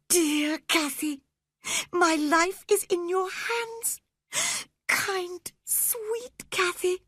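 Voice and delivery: put-on high, tearful begging boy; terrified boy pleading. A narrator's reading. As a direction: beg her, voice high and trembling with tears. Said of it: "he sobbed".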